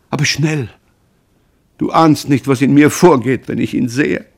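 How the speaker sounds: loud, high-pitched, agitated pleading; panicked outburst. Acted. Mother tells son her dread of his brother, panicked. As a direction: blurt it out in mounting dread.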